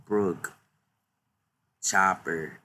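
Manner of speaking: normal talking voice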